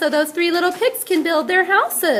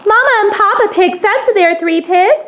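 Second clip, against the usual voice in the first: loud, higher storyteller voice; storytelling narration; 3 semitones higher, 6 dB louder than usual. A narrator's reading. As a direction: tell it big to the audience. Teacher narrates the play loudly.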